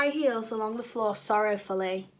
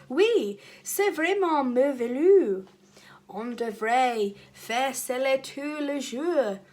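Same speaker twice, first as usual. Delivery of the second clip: excited declaiming; 2 semitones higher, slower than usual. Acted. Teacher gushes to class in French, excitedly declaiming. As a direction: show off your French with delight. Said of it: "still declaiming excitedly in French".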